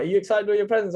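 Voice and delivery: lightly mock-excited parental question; parents' eager question. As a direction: pitch it up like parents checking on a child. Voicing parents asking about presents.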